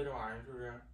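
usual speaking voice

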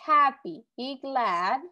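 ordinary talk

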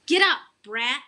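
loud mock yell; yelling in his ear. A narrator's reading. Sister yells at her sleepy brother, loud. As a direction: bark it right into his ear. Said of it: "yelling in my ear".

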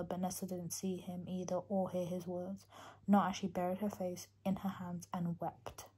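soft emotional book reading; quiet reading of a sad passage; reading aloud. Acted. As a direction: read the passage quietly, moved.

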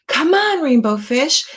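high sing-song call; calling fish friends. Acted. Fish friends calling Rainbow Fish over to play, high and bright. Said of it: "they would call".

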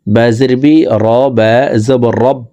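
usual speaking voice

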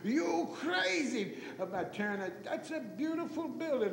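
high, indignant imitation of father; chewing them out. Father to commissioners about tearing down the courthouse, chewing them out. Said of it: "he would chew on them".

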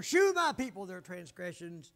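loud burst on the first word; drawn-out, forceful scripture cry, easing off; preaching shout. Shown as a demonstration. Preacher quotes Isaiah on transgressions, hollering.

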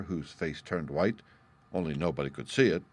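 ordinary delivery

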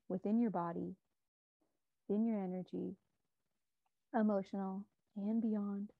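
calm, measured meditation guide with long pauses; unhurried, soothing meditation voice; guided meditation. Real, not acted. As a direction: guide listeners gently inward, leaving space between phrases.

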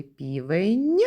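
"півень" rising onto a stressed final Нь; last sound singled out. Shown as a demonstration. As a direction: end "півень" on a lengthened, released Нь. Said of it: "І останній звук у слові".

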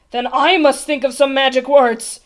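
loud, groaned royal resolve; groaning king. A narrator's reading. The stuck king must think of magic words, groaning. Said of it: "groaned the king".

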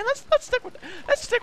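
funny voice. Acted. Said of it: "talking in a funny voice".

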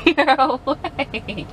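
ordinary delivery

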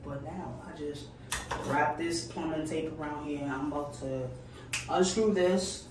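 normal talking voice

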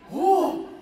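normal talking voice

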